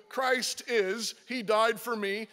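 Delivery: ordinary talk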